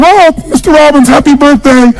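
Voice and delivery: mock the adoring maid sweetly; imitating the maid. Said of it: "my maid, she's back at home in the castle going".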